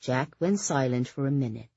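usual speaking voice

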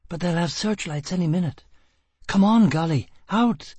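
high-pitched, urgent woman's voice; urgent woman fugitive. A narrator's reading. Woman warns her partner of searchlights, urgent. Plays Gisbella, urgent escape.

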